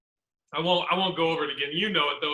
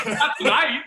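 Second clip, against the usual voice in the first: laughing punchline; 8 semitones higher, 5 dB louder than usual. Acted. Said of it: "you're like".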